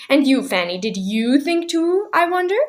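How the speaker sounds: lightly voiced menacing old woman; high little voice. A narrator's reading. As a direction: needle her daughter sweetly. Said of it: "The high little voice".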